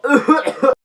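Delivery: put-on sickly coughing; exaggerated pretend cough; fake cough. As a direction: cough for show. Feigning illness to skip school.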